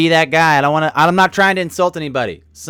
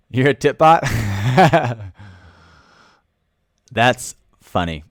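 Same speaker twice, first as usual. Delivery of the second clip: usual speaking voice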